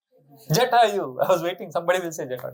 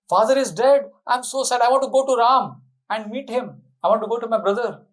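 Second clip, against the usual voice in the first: higher, pleading grief; grieving prince, voice raised high; upset; 7 semitones higher, 3 dB louder than usual. Acted. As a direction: cry out to reach his brother. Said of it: "he's very upset".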